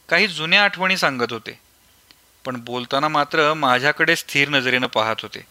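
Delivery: plain reading voice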